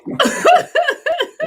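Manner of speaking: loud high real laugh at the punchline; real laughter, loud and high; bright laugh. Real, not acted. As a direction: burst out laughing, tickled. Host laughs at the joke.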